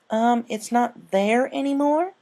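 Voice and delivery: a small filly unsure of herself; hesitant filly. A narrator's reading. Filly answers her friends, slow high voice.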